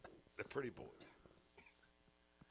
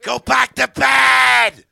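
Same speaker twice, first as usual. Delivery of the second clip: loud slow yell, much louder; shouting; 7 semitones higher, 17 dB louder, much slower than usual. Acted. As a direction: bellow it to send the kid off. Yelling at the woken child.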